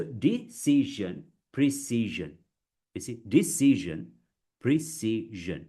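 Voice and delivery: compare "decision" and "precision" beat by beat; syllable-by-syllable. Shown as a demonstration. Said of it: "work on that".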